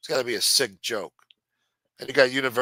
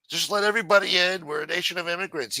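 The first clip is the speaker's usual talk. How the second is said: higher sneering parody of a slogan; mocking quote; 7 semitones higher than usual. Ranter parroting immigration supporters, higher sneering mock.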